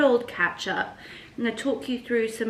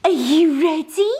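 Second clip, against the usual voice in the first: much higher and louder, bursting; excited dog talk; 7 semitones higher, 10 dB louder than usual. Real, not acted. To her dog before walkies, loud excited squeal. Hyping the dogs for walk.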